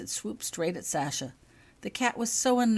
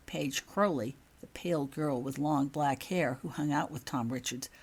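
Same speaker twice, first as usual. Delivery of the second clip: plain reading voice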